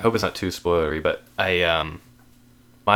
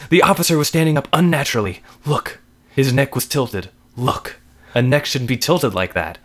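urgent, high-pitched horror narration; panicked narration; 8 semitones higher, 9 dB louder than usual. A narrator's reading. Narrator sees officer's neck tilted unnaturally, panicked narration. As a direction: keep the panic running through the telling.

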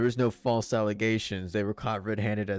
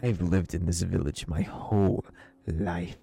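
cold, indignant villager; 4 dB louder than usual. Acted. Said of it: "He looks you dead and cold in the eyes".

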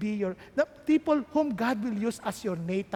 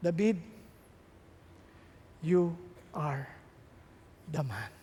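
quiet accusation; 8 semitones lower, 6 dB quieter, slower than usual. Acted. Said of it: "sabi ni Nathan".

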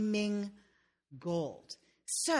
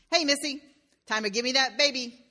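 louder and higher, a sly demand; the gremlin claims the baby, louder and higher; gremlin calling out; 6 semitones higher, 5 dB louder than usual. Acted. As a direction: call her out cheekily as the little man.

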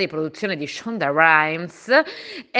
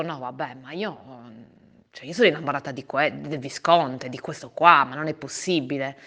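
lovesick murmur; 5 semitones lower, 5 dB quieter than usual. Retold or imitated. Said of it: "lo guardavo e dicevo".

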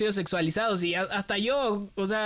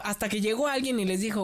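normal talking voice

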